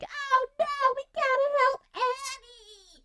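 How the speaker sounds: put-on high, frantic kid voice; panicked orphans. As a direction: play a flustered little orphan. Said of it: "The kids are like".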